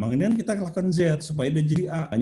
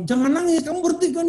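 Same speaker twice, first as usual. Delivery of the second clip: high-pitched imitation of a scolding parent; mock parental order, pitched high; 14 semitones higher than usual. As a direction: mimic a parent fussing, way up high. Acting parent ordering child to stop.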